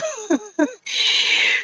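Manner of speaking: a sudden high laugh breaking out; high-pitched real laugh; high burst of laughter. Real, not acted. Laughs at spirit's blunt yes.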